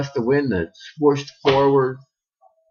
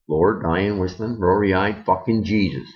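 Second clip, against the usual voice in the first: plain reading voice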